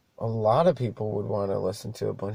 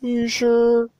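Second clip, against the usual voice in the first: higher, put-on doubtful question; mock passenger; 10 semitones higher, 4 dB louder than usual. Passenger doubts the driver's answer, mock insistent. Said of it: "quoting passenger".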